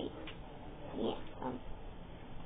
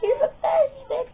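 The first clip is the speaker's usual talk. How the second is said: high, drawn-out praise voice; admiring fans; 11 semitones higher than usual. Acted. Fans praising the wrestler, high-pitched mock adoration. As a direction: gush slowly in a high put-on voice. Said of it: "Everyone says".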